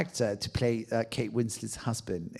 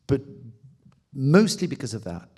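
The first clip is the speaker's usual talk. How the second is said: ordinary talk, nothing marked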